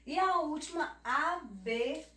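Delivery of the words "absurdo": absurdo slowed, "a-bê... surdo"; long pause after the bê in absurdo; "absurdo" broken after the named B; consonant named. Shown as a demonstration. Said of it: "falando somente a consoante".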